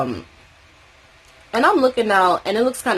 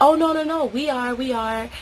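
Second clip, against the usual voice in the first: mock reassuring; 7 semitones higher than usual. Voicing him reassuring.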